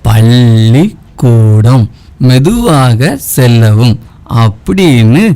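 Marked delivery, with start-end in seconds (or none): 0.1-4.0 very slow, drawn-out syllables; slow sign reading. Acted. Pupil quoting a school road sign to the teacher, slowly. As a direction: read the sign out slowly, word by word. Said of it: "board வச்சிருந்தாங்க".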